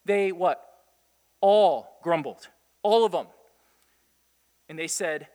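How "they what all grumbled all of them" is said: "All grumbled" hit much louder and slowed right down; stress on ALL. Shown as a demonstration. Preacher correcting the congregation, booming "All grumbled". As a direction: lean hard on "All" in "All grumbled". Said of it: "what does the text say?".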